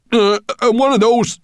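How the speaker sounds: high, loud, halting dwarf voice; nervous dwarf. A narrator's reading. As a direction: stutter it out in awe. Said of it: "he breathed".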